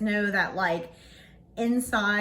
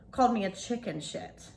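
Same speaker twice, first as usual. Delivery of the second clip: usual speaking voice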